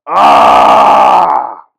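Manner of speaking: loud effortful yell; a loud yell of strain. Acted. Lifting heavy luggage, a loud strained yell.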